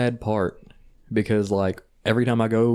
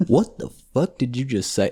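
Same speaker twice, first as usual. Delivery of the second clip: mock incredulous; 5 semitones higher than usual. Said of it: "I didn't say".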